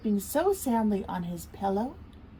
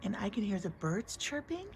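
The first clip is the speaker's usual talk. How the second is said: hushed; 10 dB quieter than usual. Real, not acted. Listening quietly to rain.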